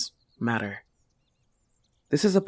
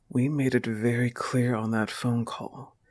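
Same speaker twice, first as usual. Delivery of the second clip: ordinary talk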